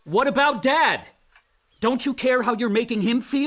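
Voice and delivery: loud and high, confronting him hard; angry shout. Acted. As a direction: yell it at him in outrage. Sister shouts at angry brother.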